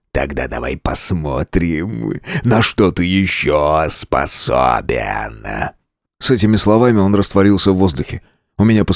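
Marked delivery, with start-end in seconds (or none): 0.1-5.5 deep, slow, much softer monster; mocking beast, low and slow. A narrator's reading. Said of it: "monster speaks".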